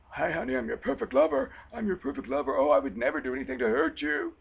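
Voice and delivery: mocking a manipulator playing the ideal boyfriend; mock perfect lover. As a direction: ham up the too-good-to-be-true charmer. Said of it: "He's pretending to be, uh, your perfect lover".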